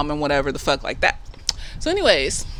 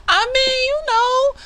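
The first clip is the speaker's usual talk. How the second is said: very high, squeaky aside; coy hedging. Pretend. Vlogger half-heartedly argues Montrose could like women, in coy falsetto. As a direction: float a doubtful maybe in falsetto. Teasing hedge against own theory.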